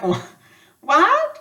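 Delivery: can't believe what she just heard; stunned disbelief. Retold or imitated. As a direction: gasp in shock at the offer. Stunned by Sonia's offer.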